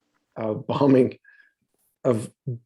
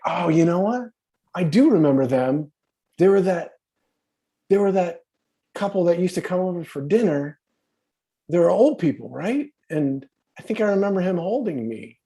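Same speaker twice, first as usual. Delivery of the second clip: higher, excited retelling of the wife; wife remembering; 6 semitones higher than usual. Retold or imitated. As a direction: lift the voice as if it just dawned on you. Wife retold, suddenly remembering.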